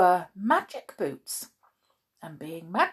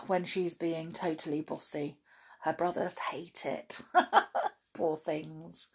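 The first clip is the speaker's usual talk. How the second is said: ordinary delivery, nothing marked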